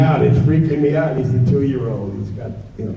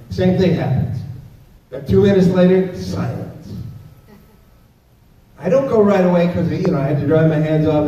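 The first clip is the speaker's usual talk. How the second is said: ordinary talk, nothing marked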